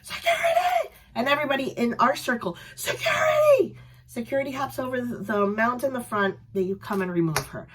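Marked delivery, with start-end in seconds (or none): 0.0-0.9 high yell with a laugh; half-laughing mock yell; laughing shout. Fan retelling her yell for security, high and laughing. 2.7-3.8 high drawn-out yell; group shout. Retold or imitated. Crowd calling security on a drunk girl, loud and high.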